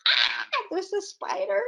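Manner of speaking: hammed-up spider fright; scared exclamation. Shown as a demonstration. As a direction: act out being startled by a spider. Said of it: "So what you're saying is".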